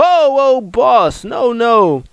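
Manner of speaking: high-pitched comic alarm; imitating a panicked underling; mock panicked pleading. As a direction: plead with the boss, voice shooting up. Retold pleading with angry boss.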